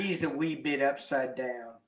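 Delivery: ordinary delivery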